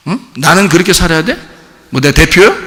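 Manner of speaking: normal talking voice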